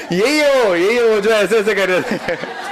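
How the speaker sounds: loud, high, laughing out the words; giggling loudly while teasing a participant. Real, not acted. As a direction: point someone out through real laughter. Teacher laughing, pointing out participant.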